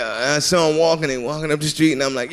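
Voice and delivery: ordinary talk, nothing marked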